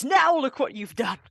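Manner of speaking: high, loud accusing outcry; horrified butler. Acted. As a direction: round on the culprit in horror. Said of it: "Jenkins turns in horror".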